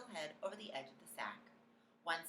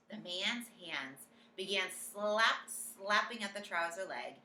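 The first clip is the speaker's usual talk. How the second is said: plain reading voice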